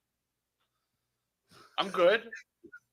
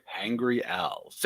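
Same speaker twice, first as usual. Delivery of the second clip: deeper, slow announcer try; low voice attempt; 10 semitones lower, 8 dB quieter, much slower than usual. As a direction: reach down for a deep read. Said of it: "I'm gonna try to do it like that".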